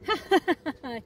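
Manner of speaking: hearty pirate laugh. Acted. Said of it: "he bellowed".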